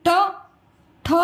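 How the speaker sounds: ट, ठ को जीभ मोड़कर धीरे से बोलो; ट और ठ मूर्धन्य, जीभ तालू पर मुड़ी हुई; ट ठ demo. Shown as a demonstration. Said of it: "अब ट बोल रहे हो तो आपकी जो जीभ है". (जीभ मोड़कर ट, ठ साफ़ बोलना)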